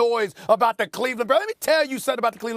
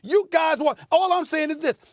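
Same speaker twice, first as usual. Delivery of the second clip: usual speaking voice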